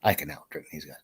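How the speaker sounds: low, quiet Texan aside; talking to himself. Acted. Texan sure he'll win, quietly to himself. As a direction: keep it down, just for yourself. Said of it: "to himself".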